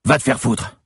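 high, angry retort; defiant outburst. A narrator's reading. Pinned man to his attacker, defiant outburst. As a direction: spit back defiance while pinned.

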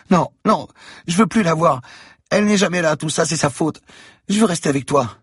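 put-on high child voice, begging; a child refusing to go home, high and insistent; upset little girl. A narrator's reading. Girl begs Franck to keep her, high pleading child voice.

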